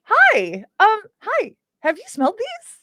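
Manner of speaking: high, excited hi; really happy greeting. Acted. Elf meeting someone new, high and happy. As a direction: greet a newcomer with delight. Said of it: "I'm just really happy".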